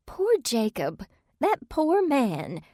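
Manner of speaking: slow, high and quavering on the edge of tears; tearful. A narrator's reading. Mother pities grieving Jacob, slow, high and tearful. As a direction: draw it out as if holding back tears. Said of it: "Trish's eyes filled with tears".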